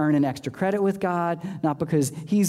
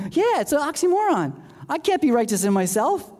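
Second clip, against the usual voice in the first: playful comeback; 10 semitones higher than usual. Retold or imitated. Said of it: "And you go".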